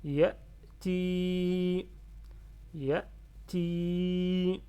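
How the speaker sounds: YA'TI with short first syllable, long loud ti; prolonged final i. Shown as a demonstration. Teacher models kasra lengthening for learners, long steady i. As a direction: hold the final i of YA'TI on a level note. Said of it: "il est là pour prolonger le kasra".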